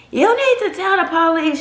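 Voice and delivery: a mom who won't take no; insistent mom. Retold or imitated.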